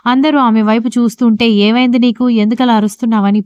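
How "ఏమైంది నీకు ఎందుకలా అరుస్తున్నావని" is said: ordinary delivery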